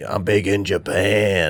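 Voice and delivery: slow, lightly put-on gruff crooner. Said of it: "you're like the [name]".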